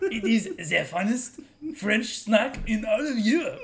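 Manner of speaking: slow, laughing mock French accent; hammy French accent tour guide; bad French accent. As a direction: oversell it in a cartoon French accent. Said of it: "we have the worst French accents".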